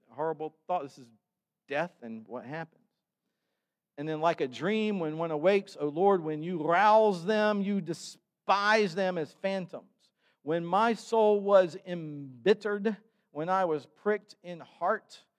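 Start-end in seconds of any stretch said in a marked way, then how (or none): 4.2-15.2 slow, higher, weighty reading; solemn scripture reading. Acted. Pastor reading the Psalm aloud, solemn and slow. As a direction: let each phrase land slowly.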